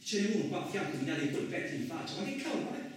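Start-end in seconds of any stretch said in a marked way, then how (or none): 2.2-2.8 bewildered. Acted. Bewildered outburst at stranger.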